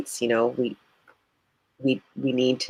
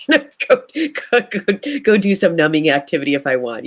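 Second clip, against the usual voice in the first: laughing; 4 semitones higher than usual. Retold or imitated. Jokingly voicing her own escape urge.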